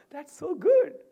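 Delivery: high, loud burst of praise; delighted high-pitched congratulating; excited congratulations. Retold or imitated. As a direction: cheer her on loudly. Cheering Padma with congratulations.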